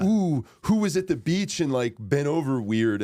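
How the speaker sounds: high, loud gossipy gasp; gossipy tabloid voice. Retold or imitated. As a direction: play a tabloid headline gushing. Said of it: "like when you go to the grocery store".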